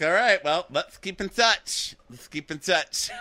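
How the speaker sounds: high, stretched-out parting call; mock calling out. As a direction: call it back while being wheeled off. Voicing him calling while wheeled away.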